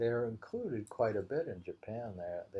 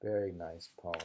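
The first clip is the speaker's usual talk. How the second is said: normal talking voice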